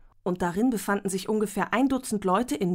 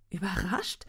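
breathless unvoiced whisper; 6 dB quieter than usual. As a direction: whisper it in disbelief. Sarah fassungslos, fragt zurück. (fassungslos geflüstert)

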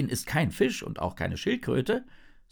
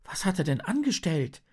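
Hannah, shocked; 10 semitones higher than usual. A narrator's reading. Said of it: "Hannah hält sich erschrocken".

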